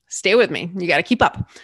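normal talking voice

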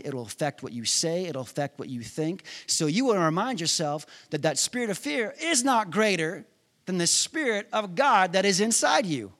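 ordinary talk

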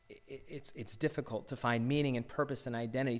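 usual speaking voice

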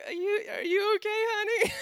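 high, fast, tearful voice of a worried wife. Retold or imitated. Wife nervously checks on her fuming husband, in a high tearful voice. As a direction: squeak it out like a frightened, teary spouse. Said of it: "She's like".

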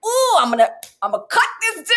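high, hammed-up threat to cut the guy; mock angry inner voice. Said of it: "I don't react when I'm like".

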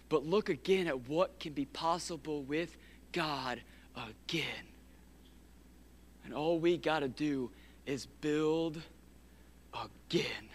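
soft, low and intimate, nearly whispered; hushed preaching. Real, not acted. Pastor leaning in to the congregation, hushed. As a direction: draw them in with a hush.

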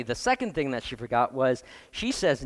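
normal talking voice

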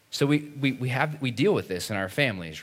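normal talking voice

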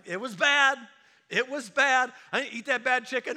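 loud mock lament; mock groaning complaint. Sick believer after bad chicken, loud mock lament. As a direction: complain loudly about the chicken.

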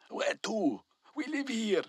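puzzled worker calls across the room; shouted back. A narrator's reading. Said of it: "One shouted back".

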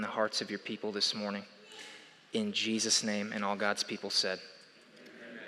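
soft, hushed prayer voice. Real, not acted. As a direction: pray quietly and earnestly. Leads a prayer.

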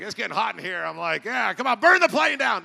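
comically escalating angry shout, rising high; hammed-up passenger losing his temper at the top of his voice; mad yelling. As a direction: build from loud griping into a full mock-furious yell. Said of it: "I was getting mad".

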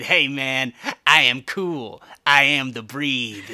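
loud, slow cool-guy jive; hammed-up Paul street talk; [name] impression. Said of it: "doing it like Paul".

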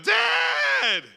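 high, loud terrified scream; boy screaming for his father; scared child scream. Acted. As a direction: scream for dad in fright. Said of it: "he screams out".

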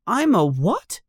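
put-on high-pitched astonished boy; stunned, high child's voice; gasping. A narrator's reading. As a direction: blurt it high in amazement. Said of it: "gasps Harry".

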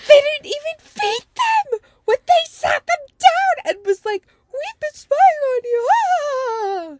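high-pitched laughing cry of disbelief; laughing outrage. Real, not acted. Cohost laughing at the parents' spying, very high.

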